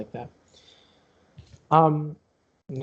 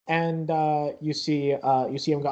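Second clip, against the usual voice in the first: ordinary talk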